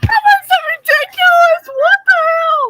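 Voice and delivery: loud, high squawking imitation of the friend; ridiculous impression. Mocking the AI friend in a loud, high, over-the-top imitation. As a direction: yell it as a terrible copy of your friend. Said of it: "you sound nothing like me".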